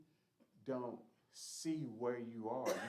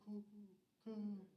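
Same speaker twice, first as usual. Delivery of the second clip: imitated dove coo, soft and higher; 10 semitones higher, 5 dB quieter than usual. Preacher showing how doves sound, imitated cooing. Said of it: "you know how you hear the doves out there and go".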